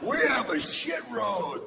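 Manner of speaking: high-pitched comic shout. Acted. Shouted escalation.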